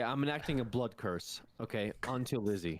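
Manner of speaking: ordinary delivery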